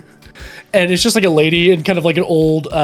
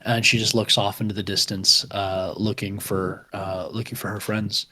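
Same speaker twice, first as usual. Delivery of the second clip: game master narration; 4 semitones lower, 6 dB quieter than usual. A narrator's reading. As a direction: paint the desert horizon quietly. GM scene narration.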